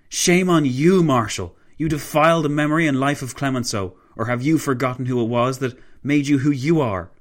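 loud, high-pitched reproach; yelled condemnation. A narrator's reading. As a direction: hurl the rebuke at him. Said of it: "Clavel yelled".

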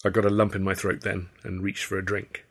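ordinary delivery

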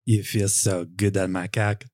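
mock French accent. Man in the story to the clown, playful French accent. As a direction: purr nothing extra, just play it French. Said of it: "me doing this accent".

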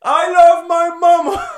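put-on loud, high, drawn-out man-child cry; Bobby Boucher. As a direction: declare it slowly in a high, shouted voice. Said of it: "My name's Bobby Boucher".

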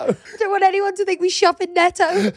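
put-on high, wailing kid's protest; mock mortified whine. Embarrassed child to dad about Netto, high mock whine. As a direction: act out an embarrassed child pleading. Child's plea, laughing retelling.

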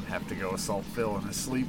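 normal talking voice

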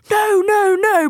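high, loud, frantic no no no; frantic shout. Retold or imitated. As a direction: wave them off in a panic. Mum frantically waving van away.

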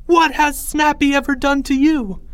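high, loud impassioned protest; indignant plea. A narrator's reading. Pleading with a crowd against Snappy, indignant cry.

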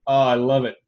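usual speaking voice

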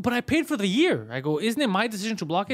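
high, loud indignant pushback; indignant protest. Retold or imitated. Customer arguing with shop guy, indignant. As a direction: insist it's your call.

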